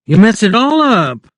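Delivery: wife crying out angrily as he musses her hair; cried angrily. A narrator's reading. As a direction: snap at him to stop. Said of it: "she cried angrily".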